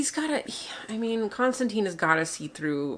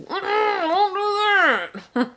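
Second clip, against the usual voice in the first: slow, drawn-out mock Scottish voice; deep Scottish accent; 12 semitones higher, much slower than usual. Co-host mimicking Finan's warning, mock Scottish accent. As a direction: imitate a thick Scottish accent, stretched out slowly. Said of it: "Like the deep Scottish accent".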